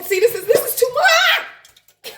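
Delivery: high, slow, laughing fright; half laugh, half panic at the grease; scared giggle over hot oil; nervous laughing panic. Real, not acted.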